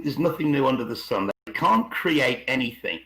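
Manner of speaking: ordinary talk, nothing marked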